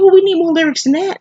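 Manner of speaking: high-pitched mimicked complaint; mimicked complainer. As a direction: imitate someone pestering, pitched well up. Mimics son demanding more lyrics.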